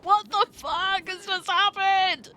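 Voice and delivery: stunned at what just happened; shocked. Real, not acted. Shock after sudden event.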